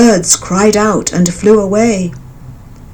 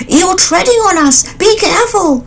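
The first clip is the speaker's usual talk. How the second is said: high frog voices, calling out; shouting frogs; 12 semitones higher than usual. A narrator's reading. Frogs shouting up at the elephant, high. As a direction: cry out to warn the big beast. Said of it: "they shouted".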